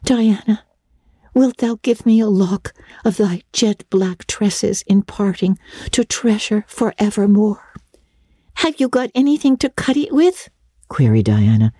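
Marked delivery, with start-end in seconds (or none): 0.0-7.6 put-on higher girlish voice, theatrically solemn; solemn, affecting. A narrator's reading. Anne asks parting Diana for a lock of hair, solemn. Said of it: "Anne's affecting accents". 8.6-10.5 put-on high little-girl voice; Diana turning practical. A narrator's reading. Diana, tears wiped, asks for scissors, practical girlish query. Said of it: "returning to practicalities".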